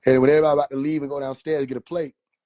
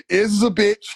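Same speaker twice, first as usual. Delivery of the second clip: cracking up, louder; laughing; 5 semitones higher, 6 dB louder than usual. Real, not acted. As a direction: blurt it through laughter. Laughing at own joke.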